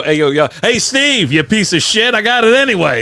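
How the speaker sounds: high, loud retold jeer; mock taunt. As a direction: jeer at Steve like a cocky rival.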